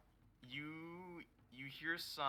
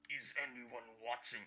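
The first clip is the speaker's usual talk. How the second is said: attempted character voice. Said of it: "I can't do this voice".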